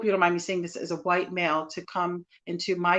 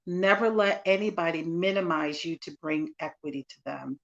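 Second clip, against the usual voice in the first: ordinary talk, nothing marked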